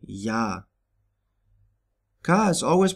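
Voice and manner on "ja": Ja starting with an English Y sound. Shown as a demonstration. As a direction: say Ja as "yah". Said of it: "pronounced like Y in English".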